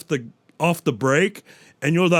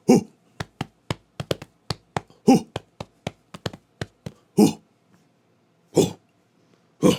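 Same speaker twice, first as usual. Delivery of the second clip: gorilla hoots; 5 semitones higher than usual. Acted. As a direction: hoot like a chest-beating gorilla. Gorilla calls between lines.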